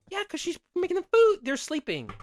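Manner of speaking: very high, loud sarcastic claim; mock her argument. Real, not acted. Host sarcastically defends the mother, very high and loud.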